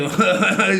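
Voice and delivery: short self-amused chuckle; amused laugh. Real, not acted. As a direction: laugh at your own modest success. Laughs at own subscriber count.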